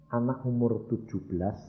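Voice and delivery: ordinary delivery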